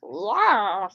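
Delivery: ordinary delivery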